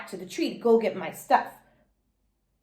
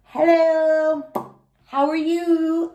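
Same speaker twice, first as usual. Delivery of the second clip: nervous; 6 semitones higher, slower than usual. A narrator's reading. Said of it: "kinda nervous".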